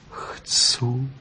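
soft, whispered consent; humbly. A narrator's reading. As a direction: give in meekly, barely voiced. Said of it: "odvětila pokorně". (pokorně, šeptem)

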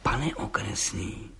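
slow, soft, low insinuation; vengeful. Acted. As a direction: address the judge slowly and softly, plotting revenge. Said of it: "řekl mstivě".

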